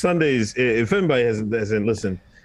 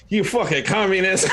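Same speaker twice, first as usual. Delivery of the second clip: laughing retold insult; 8 semitones higher than usual. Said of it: "I was like".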